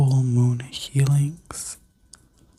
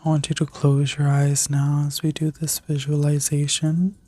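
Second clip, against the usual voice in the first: sleep story narrator. Acted. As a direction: guide them gently into rest. Guided visualization continues.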